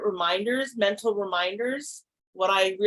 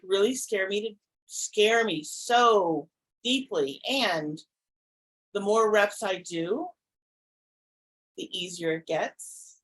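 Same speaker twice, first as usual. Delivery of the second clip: ordinary talk, nothing marked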